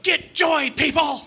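high mock preacher's yell. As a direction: order joy as if scolding the congregation. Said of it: "you sound like you're yelling at us".

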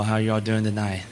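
usual speaking voice

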